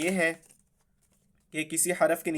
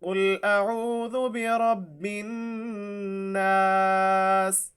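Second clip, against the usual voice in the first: slow melodic tajweed chant, higher; Quran recitation; 3 semitones higher, much slower than usual. Shown as a demonstration. Teacher reciting the surah once through for students.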